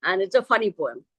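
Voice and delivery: ordinary talk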